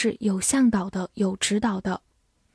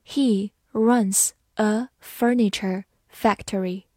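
slow, word by word; slow reading; slower than usual. Shown as a demonstration. English teacher models the furniture sentence for learners, slow reading. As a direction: read slowly with gaps between the words. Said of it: "好，慢读一遍".

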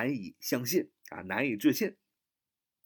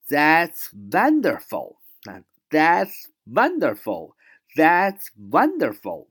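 louder, drawn-out "wonderful"; delighted; 4 semitones higher, 7 dB louder, much slower than usual. Shown as a demonstration. Teacher acting out delight at great news. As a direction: sound truly thrilled, slowly.

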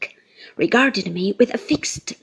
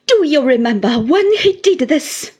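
loud, high, impassioned challenge; furious confrontation; bitter accusation; 4 semitones higher, 6 dB louder than usual. A narrator's reading. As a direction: demand an answer in anger. Rosa's tirade against the mother.

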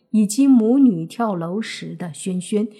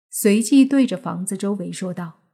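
ordinary delivery

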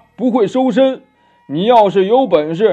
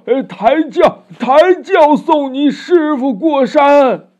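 high, loud, drawn-out groaning plea; agonized begging; 8 semitones higher, 6 dB louder, slower than usual. A narrator's reading. Old demon pleads with Wukong inside his belly, pained wailing. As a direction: beg for mercy through hammed-up pain. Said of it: "老魔疼痛难忍".

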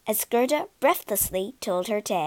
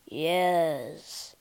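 lower, slow reindeer voice; slow deep voice; 5 semitones lower, 4 dB quieter than usual. Acted. Reindeer answers the pigeons, low and slow. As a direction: speak like a big calm reindeer. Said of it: "he said in a slow, deep voice".

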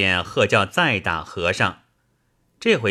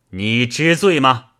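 raised, louder stern question from the bench; stern demand; 4 semitones higher, 5 dB louder than usual. A narrator's reading. Magistrate slams the gavel and demands the monk confess, stern and louder. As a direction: bark it down at the accused.

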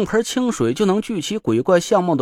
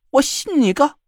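higher, swallowing the last word; cut-off retort; 9 semitones higher than usual. A narrator's reading. Joker stopping short of swearing: cut-off. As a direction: bite off the curse. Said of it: "把最后一个字儿吃了进去".

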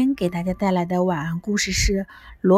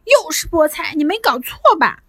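high, indignant complaint; boy protesting spinach to his father; shouting at dad; 6 semitones higher than usual. A narrator's reading. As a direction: yell it across the dinner table. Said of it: "他冲着爸爸叫道".

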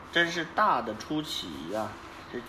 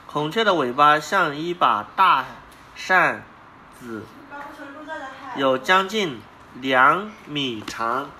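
clear, lively read-aloud voice; reading to children. Acted. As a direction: read the animal book to a child. Parent reads picture book aloud.

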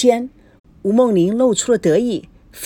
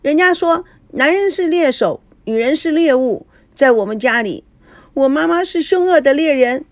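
put-on whiny child; 8 semitones higher than usual. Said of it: "菲菲一面哭一面说".

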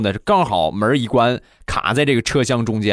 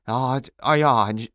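normal talking voice

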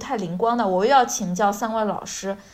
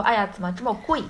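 mock shocked haggling; 3 semitones higher, faster than usual. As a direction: act shocked at the price. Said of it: "如果怕阿姨赞你，就是说".

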